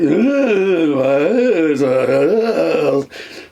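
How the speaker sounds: imitated wobbly record sound, loud swooping drone; drawn-out mock wail of a dished record; warped record noise. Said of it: "so it went".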